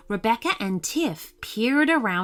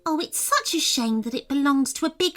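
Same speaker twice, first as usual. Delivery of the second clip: put-on higher, girlish fairy voice; fairy Rebecca's voice; 7 semitones higher than usual. Rebecca the fairy admits the soup smells good, higher fairy voice. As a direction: lift it up into the little fairy.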